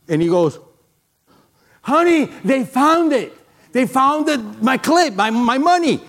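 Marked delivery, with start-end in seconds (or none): 1.8-6.0 thrilled man calling his wife that the clip is found; overjoyed. Retold or imitated.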